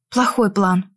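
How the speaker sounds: ordinary delivery, nothing marked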